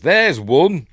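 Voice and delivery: high, loud spotting shout; acted trooper alert; stormtrooper callout. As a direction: call out a sighting to your squad. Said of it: "so he says".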